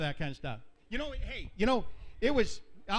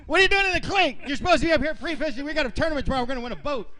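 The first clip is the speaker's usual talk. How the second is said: laughing, much higher and louder, half yelling; exasperated shout; 8 semitones higher, 9 dB louder, faster than usual. Retold or imitated. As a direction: yell at him through laughter, fed up. Retold outburst at partner in jail.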